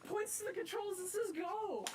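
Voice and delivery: high, breathless, giddy laughter through the words; laughing excitement. Real, not acted. Rail fan telling the camera after driving the engine, giddy laughter. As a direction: gush it out while laughing. Repeat of same clip.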